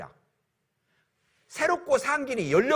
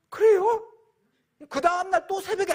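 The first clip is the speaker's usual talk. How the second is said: much higher, louder startled question; surprised son; 11 semitones higher, 4 dB louder than usual. Acted. Son startled to hear he was forgiven, surprised. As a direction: pop the question up high in surprise.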